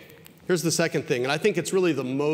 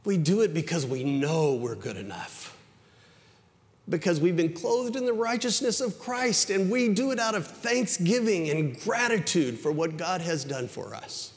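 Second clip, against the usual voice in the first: higher, louder, urgent preacher's voice; full-force preaching; 10 semitones higher, 5 dB louder than usual. Acted. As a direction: press the grace message on the congregation.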